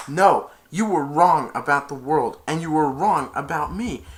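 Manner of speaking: loud, high condemnation; child's hammed-up heroine fury; defiant. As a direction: tell her off for good. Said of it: "and she said".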